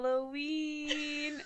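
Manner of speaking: slow, drawn-out ghostly Halloween; spooky voice. Pretend. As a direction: moan it like a ghost. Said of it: "say Halloween in a spooky voice".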